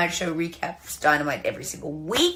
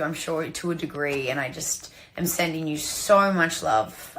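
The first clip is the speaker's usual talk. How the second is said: lower, softer condolence; soft, low-pitched sincerity; tender sympathy; 3 semitones lower, 4 dB quieter than usual. Real, not acted. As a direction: offer heartfelt comfort, gently and quietly. Condolences for a lost father.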